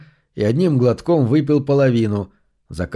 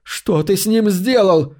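high, slow cry of demand; shouted question; 7 semitones higher, slower than usual. A narrator's reading. Cassandra shouts at Max over dying Harry. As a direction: yell the question at him. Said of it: "выкрикнула Кассандра".

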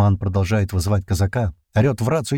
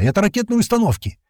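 high-pitched cry of alarm; alarmed yell; 12 semitones higher than usual. A narrator's reading. Narrator warning the lads of launchers, alarmed yell. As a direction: sound the alarm urgently. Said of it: "уже заорал я".

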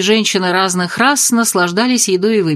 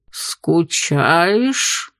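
low bubbling alien voice; gurgling tentacle alien; 3 semitones lower than usual. Acted. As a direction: gurgle it like an octopus. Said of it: "пробулькал осьминог".

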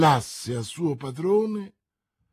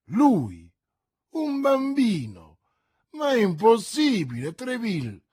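disbelieving cry, leaping high on bambino; a king who cannot believe it; astonished; 5 semitones higher, 3 dB louder than usual. A narrator's reading. (stupore incredulo, acuto su bambino)

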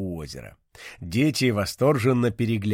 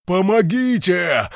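high, slow cry through bubbling water; gurgling; 7 semitones higher, 6 dB louder, slower than usual. Acted. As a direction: call for help while sinking. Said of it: "пробулькал кулон".

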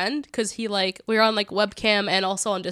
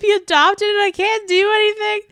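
higher, louder whiny wail; hammed-up tearful plea; mock crying lament; 9 semitones higher, 5 dB louder than usual. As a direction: wail it like a melodramatic sob. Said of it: "I was like".

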